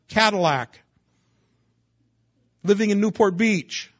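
loud, rising sermon delivery; forceful preaching. Acted. Pastor thundering at the congregation, loud and rising. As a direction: preach it hard, building to a peak.

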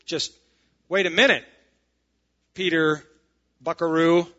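loud, halting protest to the leader; mock objection. Imagined church member stopping Peter mid-decision, mock objection. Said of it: "No record of anybody going".

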